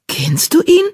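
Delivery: high, surprised question; astonished. Acted. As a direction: ask in amazement, voice shooting up. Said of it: "fragte Blattpfote erstaunt".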